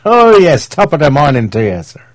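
high, loud mock-Irish leprechaun accent; hammed-up fake Irish lilt, pitched high. Host mocking a fake Irish accent, in exaggerated leprechaun Irish. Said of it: "dear Irish friend called leprechaun Irish".

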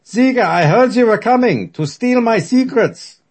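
put-on thick German accent, loud and high. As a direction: boom it out in heavy German. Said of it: "In his thick German accent".

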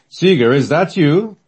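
slow, high, loud call from beyond; disembodied voice. Acted. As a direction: call out from the spirit world. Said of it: "a disembodied voice calls out".

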